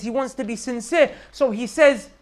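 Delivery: ordinary talk, nothing marked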